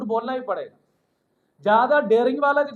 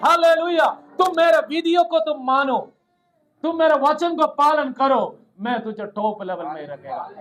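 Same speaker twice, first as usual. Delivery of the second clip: raised, emphatic preacher's proclamation; full-force preaching; 2 semitones higher, 4 dB louder than usual. Real, not acted. Preacher assuring worshippers that God will lift them up, preaching forcefully. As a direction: drive each phrase home to the congregation.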